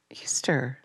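scornful whisper. Real, not acted. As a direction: spit the word out under your breath. Scornful aside in a sermon.

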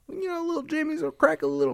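put-on squeaky caricature of an old-timer asking for jimmies. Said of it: "And then they're like".

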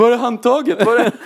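high, loud mock-distraught kid voice; frantic acted questioning. Distraught friends greeting the boy home from hospital, frantic high questioning. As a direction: pipe up loudly, urgently searching.

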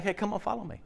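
soft, casual invitation; quiet, slightly high, easygoing call; not intimidating. Acted. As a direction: invite them along gently. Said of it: "it's not like he had to have been, like, that intimidating".

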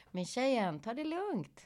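imitating a man's soft brush-off; quiet, patronizing mimicry; retold calm-down. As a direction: softly tell her off, as a quote. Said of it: "Och han bara".

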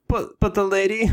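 high, whiny protest; hammed-up indignant plea; mock protest. Protesting about the lady.

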